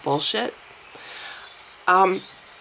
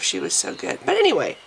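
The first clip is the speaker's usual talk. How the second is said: usual speaking voice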